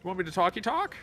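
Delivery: high, goofy mock character voice; mocking a dim game character, high; silly character voice. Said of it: "and he's just like".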